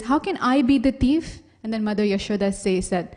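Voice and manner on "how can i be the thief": louder, higher mock-indignant question; indignant protest. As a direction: protest the charge, raised voice. Krishna protests the accusation.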